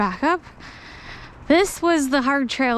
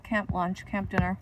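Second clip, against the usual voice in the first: usual speaking voice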